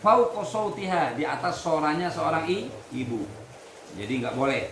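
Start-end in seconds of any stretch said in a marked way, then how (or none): 0.0-1.1 slow, loud, higher reading chant; Arabic recitation. Acted. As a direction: intone the Arabic text slowly for the class. Teacher reads Arabic text aloud.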